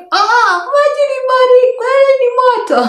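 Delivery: very high, wailing mock panic; panicked house help. House help reacting to the burnt girl, mock panicked squeal. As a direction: squeal it in an exaggerated flustered voice.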